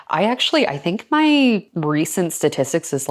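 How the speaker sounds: normal talking voice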